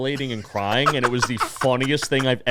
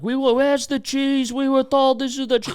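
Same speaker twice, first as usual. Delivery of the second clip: put-on high, slurred drunken heckling; drunk hecklers; 12 semitones higher than usual. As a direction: imitate two drunks calling out, pitched way up. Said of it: "drunkly saying".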